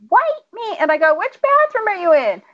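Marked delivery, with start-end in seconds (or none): 0.1-0.8 put-on high little-kid call; high-pitched, playful mock summons; imitated child calling. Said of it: "He would say". 1.2-2.4 parent calling back. Retold or imitated.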